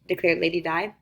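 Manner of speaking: ordinary delivery, nothing marked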